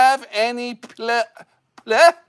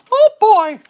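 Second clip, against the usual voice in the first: high, loud cartoon mouse voice; Mickey Mouse; 10 semitones higher, 6 dB louder than usual. Showing off his impression to viewers, high cartoon mouse voice. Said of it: "I'm gonna do my Mickey Mouse impression".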